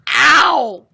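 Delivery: sharp loud ow; pained yelp. Real, not acted. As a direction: yelp in pain. Took fall damage.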